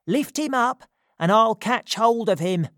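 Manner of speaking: urgent call, pitched well up. A narrator's reading. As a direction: direct an accomplice from above. Said Crackit from the top of the wall.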